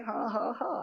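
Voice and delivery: quiet spoken ha ha ha; a hushed taunting laugh; soft mocking laugh. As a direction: laugh at him under your breath. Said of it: "the tree laughs at him and says".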